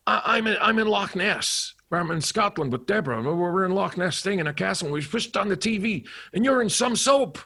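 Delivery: high, excited director on the phone; excited, high-pitched impression; John Landis. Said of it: "he went".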